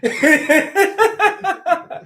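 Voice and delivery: loud high laugh; hearty laughing burst; high burst of laughter. Real, not acted. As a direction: crack up at the chat. Big laugh at the chat.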